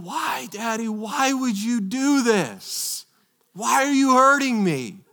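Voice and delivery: put-on high, pleading child's cry; screaming little girl. Daughter pleading with dad during stitches, high and scared. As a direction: beg like a scared toddler, pitched well up. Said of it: "she's looking up at me screaming".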